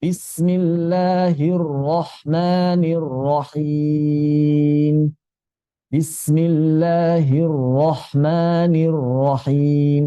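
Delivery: Bismillahirrahmanirrahim chanted slowly in tajwid style; slow Quran recitation. Shown as a demonstration. Teacher reciting the basmalah so students copy it. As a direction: recite it slowly and evenly, as in prayer. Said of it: "Cara bacanya mesti sama".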